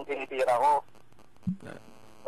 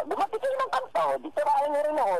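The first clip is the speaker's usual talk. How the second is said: taunting; 10 semitones higher than usual. Real, not acted. Taunting caller in phone fight.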